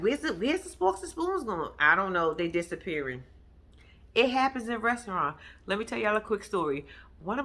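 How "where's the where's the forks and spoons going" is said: higher-pitched questioning imitation; lightly mimicked questioning; imitating Candy. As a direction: voice a puzzled boss, pitched up. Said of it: "So Candy's like".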